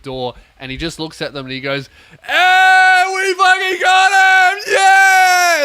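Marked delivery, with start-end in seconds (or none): 2.2-5.6 high, drawn-out victory yell; wild celebration. Acted. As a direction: roar it like a winning team. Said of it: "they all just start celebrating".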